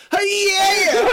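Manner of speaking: high, loud hype-man yell; hype shout. Retold or imitated. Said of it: "just be like".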